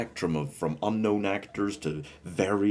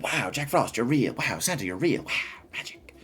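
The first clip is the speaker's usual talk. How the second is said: higher, swooping mock-awed child; wide-eyed kids; 5 semitones higher than usual. Imitating kids meeting Jack Frost and Santa, awestruck. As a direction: gush in wonder, fading to a whisper. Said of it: "the kids are just like".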